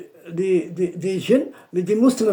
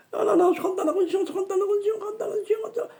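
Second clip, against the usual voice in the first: fast, high frightened giving in; hasty high submission; 14 semitones higher, much faster than usual. Retold or imitated. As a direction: gabble it out to save yourself. Djinn hastily submits. (soumission rapide et aiguë, apeurée)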